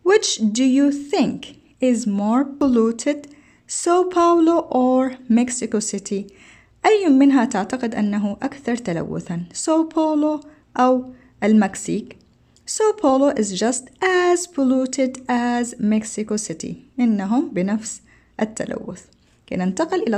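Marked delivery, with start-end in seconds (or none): 0.1-6.3 slow textbook reading. Shown as a demonstration. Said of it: "teacher reads textbook question aloud". 12.7-16.7 slow textbook reading. Shown as a demonstration. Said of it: "teacher reads textbook answer aloud".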